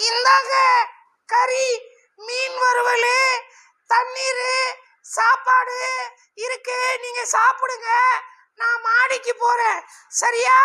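husband's wife sending him off, high falsetto shout; shrill wife voice. Acted. Man voices the wife again.

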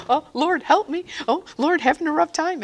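high pleading cry to the Lord; mock plea for help. Praying on a rough day, a high pleading cry. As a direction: plead like someone at the end of their rope.

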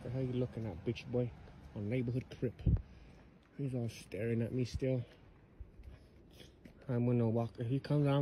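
hushed. Real, not acted. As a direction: keep it down so he can't hear. Talking low about staring stranger.

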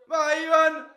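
loud urgent yell; shouting. Real, not acted. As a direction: yell him forward. Fan urging player on.

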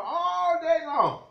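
"all day long" stretched high and slow; drawn-out emphasis. Real, not acted. Stresses how long the hollering lasts.

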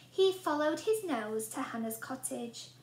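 ordinary delivery, nothing marked

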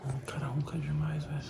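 soft whisper by a sleeper; whispering while hiding. Real, not acted. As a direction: whisper under the snoring. Whispering beside snoring friend.

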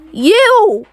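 a loud, high pointed "You!"; high, loud accusation; accusing shout. Pretend. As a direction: call out the culprit. Accusing the culprit in play.

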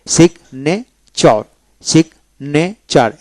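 সিগনেচার said very slowly, sig-ne-char with the g sounded; slow model word. Shown as a demonstration. Teacher modeling সিগনেচার for learners, slow and careful. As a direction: draw out সিগনেচার so each syllable is heard. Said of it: "তখন কিন্তু উচ্চারণ হবে".

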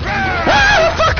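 sudden high shriek of fright; jump-scare yell; real startled scream. Real, not acted. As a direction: scream in shock, then swear. Startled by a yelling enemy.

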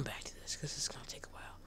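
quick hushed whisper; soft whispered aside. Real, not acted. Giving up on goats.

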